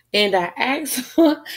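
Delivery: giggling, high voice; laughing. Real, not acted. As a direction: laugh through the words. Laughs at her own gift request.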